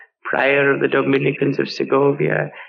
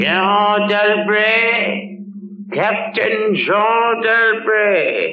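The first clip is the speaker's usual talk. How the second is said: drawn-out calling of the name, raised; slow summoning call; 5 semitones higher, much slower than usual. Acted. Inquisitor summoning the prisoner, drawn-out raised call. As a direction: call the name out slowly across the hall.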